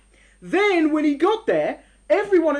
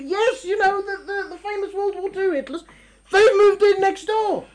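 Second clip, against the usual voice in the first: higher, louder, slowing at the end; emphatic confirmation; 10 semitones higher, 6 dB louder than usual. Acted. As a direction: spell out the absurd fact. Confirming the famous Hitlers.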